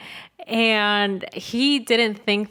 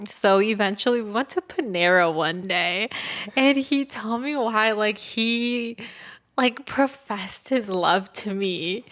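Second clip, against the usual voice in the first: ordinary talk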